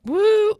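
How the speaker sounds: loud high-pitched woo; high cheer. Real, not acted. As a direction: whoop it up. Cheer after announcing update.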